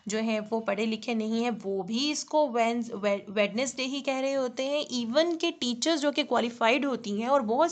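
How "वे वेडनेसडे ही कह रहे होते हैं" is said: ordinary delivery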